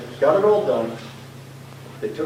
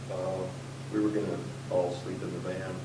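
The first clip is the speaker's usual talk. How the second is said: soft, hesitant, a bit embarrassed; sheepish mumble; 6 semitones lower, 11 dB quieter than usual. Retold or imitated. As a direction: quietly admit the awkward plan. Sheepish answer.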